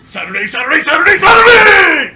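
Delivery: building to a booming shout; monster truck announcer. Acted. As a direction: start big, end yelling it.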